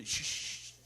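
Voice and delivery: soft hissed shh calls; hissed shushing. Retold or imitated. Diner shushing to call the waiter, soft hiss.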